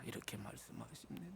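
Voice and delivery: hushed whisper after the warning. Acted. Preacher whispering to the congregation after a warning. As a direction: breathe it out almost silent.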